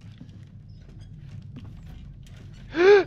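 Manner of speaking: sharp high gasp of fright; startled gasp. Real, not acted. Player startled by the pursuer, startled gasp. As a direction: suck in air in shock.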